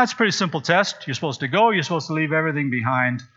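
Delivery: ordinary talk, nothing marked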